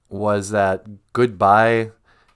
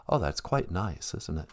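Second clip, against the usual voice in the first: lower, soft British rock star caller; 4 semitones lower, 10 dB quieter than usual. Acted. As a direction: answer as a softly spoken English celebrity.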